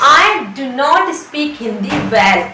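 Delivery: very slow, stretched out; slow teaching demo. Shown as a demonstration. Teacher repeating the sentence slowly for students. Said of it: "मैं अच्छी तरीके से".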